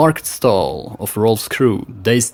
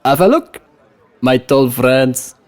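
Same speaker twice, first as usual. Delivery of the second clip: put-on West Frankish accent; 3 semitones higher, 4 dB louder than usual. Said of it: "with a subtle smile".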